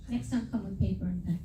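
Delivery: ordinary delivery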